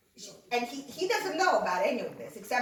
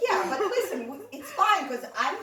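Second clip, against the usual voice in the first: laughing; 8 semitones higher, 6 dB louder than usual. Retold or imitated. Reassuring mom while laughing.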